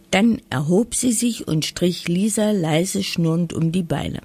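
plain reading voice

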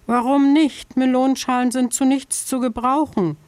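höher gesprochenes Häschen, leicht quengelnd; Häschen, klagend. A narrator's reading. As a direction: kleines Tier, das nicht aufgibt. Häschen widerspricht bittend. (Häschen fragt die Vogelscheuche nach dem Warum, höher und quengelnd)

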